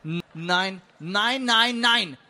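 stuttered start building to loud nos; calling out refusals. Acted. The spirit that always denies objects, calling out. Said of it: "rief der Geist, der stets verneint".

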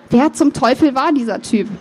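ordinary delivery, nothing marked

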